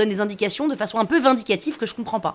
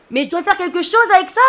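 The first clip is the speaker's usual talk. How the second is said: high, loud question hollered upward; shouting back up; 8 semitones higher, 6 dB louder than usual. Acted. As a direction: call the question up to the mast. Shouting back up to friend on mast.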